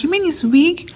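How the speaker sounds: high, shocked question; shocked lab mate. Retold or imitated. Lab mate shocked to learn it is a wig, high and astonished. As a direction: imitate her shock, voice jumping up. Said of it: "she was so shocked".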